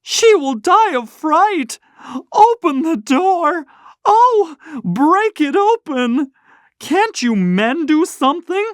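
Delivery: put-on a mother terrified for her child; hysterical scream. A narrator's reading. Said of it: "screamed the mother".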